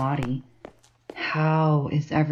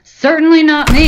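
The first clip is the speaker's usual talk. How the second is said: shouted much higher and louder; furious outburst; 12 semitones higher, 14 dB louder than usual. Acted. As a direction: explode on the last line. Rant peaks in outburst.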